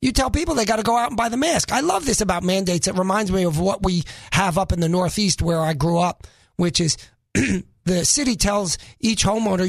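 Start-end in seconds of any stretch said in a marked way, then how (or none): none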